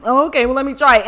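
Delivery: ordinary talk, nothing marked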